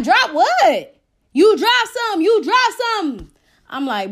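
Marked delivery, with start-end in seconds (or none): 0.0-1.0 raised-voice retold question; indignant retort. Cousin's indignant reply to yelling man. 1.3-3.3 high, loud mock shout repeated insistently; pitched-up mock holler; retold yelling. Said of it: "He's yelling down the street like".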